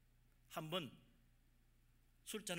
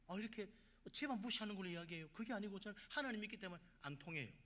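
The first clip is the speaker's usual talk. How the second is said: ordinary delivery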